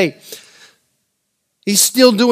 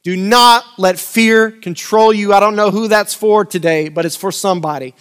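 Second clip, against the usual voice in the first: louder preaching voice, driving the scripture; 4 semitones higher, 3 dB louder than usual. Acted. Preacher reading the storm scripture to the church, in full preaching voice. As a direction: preach it to the congregation.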